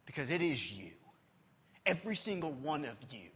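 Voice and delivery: ordinary talk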